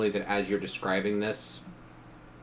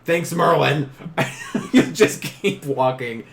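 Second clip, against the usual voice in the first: sarcastic thanks; 6 semitones higher, 7 dB louder than usual. Pretend.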